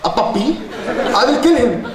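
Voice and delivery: father threatening the suitor, mock furious; angry father. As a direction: threaten like a furious dad, half laughing. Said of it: "her father misunderstood".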